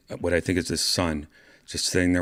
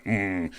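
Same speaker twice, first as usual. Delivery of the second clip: tragedy mask hum; 4 dB quieter than usual. Shown as a demonstration. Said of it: "the other one's like".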